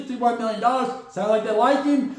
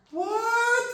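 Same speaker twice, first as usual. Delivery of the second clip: mock surprise; 12 semitones higher than usual. Mock disbelief.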